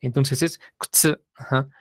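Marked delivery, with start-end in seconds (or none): 0.8-1.2 kutsu with both U's nearly dropped, kts; silent U's. Shown as a demonstration. Teacher models kutsu for students with both U's silent. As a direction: clip the U's in kutsu to a whisper. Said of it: "aquí las dos U's son mudas".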